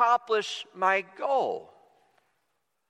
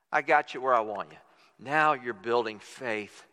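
God's assurance; 9 semitones lower than usual. Retold or imitated. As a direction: drop down deep and let each word settle. God's reassuring answer, voice drops.

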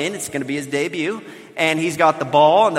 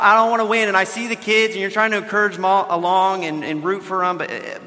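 usual speaking voice

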